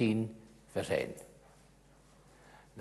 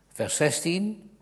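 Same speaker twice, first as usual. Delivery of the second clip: normal talking voice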